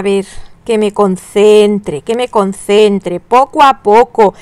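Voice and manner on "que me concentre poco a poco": ordinary delivery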